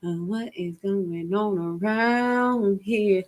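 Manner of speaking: slow, singsong astonished "what is going on"; drawn-out disbelief. Acted. Host reacting to stuff that blows their mind, drawn-out disbelief. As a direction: stretch the words out long and slow. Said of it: "I be like".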